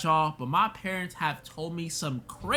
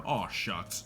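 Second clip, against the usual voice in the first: a soft, lowered aw shucks in a put-on wholesome voice; 8 semitones lower, 8 dB quieter than usual. Complaining that people mock him, a quiet put-on aw shucks. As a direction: toss it off quietly like a clean-cut suburban guy. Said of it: "Why are you doing that voice?".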